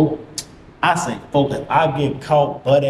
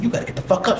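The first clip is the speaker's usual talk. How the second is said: higher, urgent mimicked woman; voicing the woman; 6 semitones higher, much faster than usual. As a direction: hurry him along in her raised voice. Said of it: "She said".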